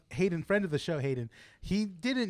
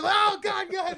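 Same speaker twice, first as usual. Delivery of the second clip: high, loud mock shout; mock yell; 12 semitones higher, 5 dB louder, slower than usual. Retelling the show, imitating the character's shout while hitting. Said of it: "starts hitting it".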